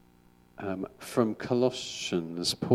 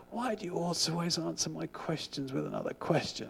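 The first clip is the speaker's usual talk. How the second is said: a raised, exasperated disciple asking; frustrated disciple; 8 semitones higher, 4 dB quieter than usual. Retold or imitated. Disciple complaining to his rabbi, mildly exasperated. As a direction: whine it like a fed-up student. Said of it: "He says".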